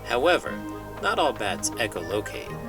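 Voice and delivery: ordinary delivery, nothing marked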